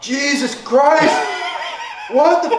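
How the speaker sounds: high loud yelling in pain; drawn-out shout of burning pain. Real, not acted. Hot sauce pain peaks.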